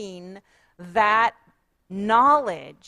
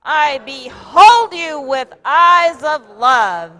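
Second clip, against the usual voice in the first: much louder and higher, slow; loud, slow, higher shout to the whole room; really loud shout; 5 semitones higher, 12 dB louder, slower than usual. Shown as a demonstration. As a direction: shout it one more time with everything. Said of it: "really loud".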